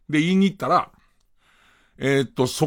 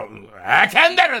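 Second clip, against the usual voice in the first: indignant heckle; faster than usual. Pretend. Heckles the actor on screen.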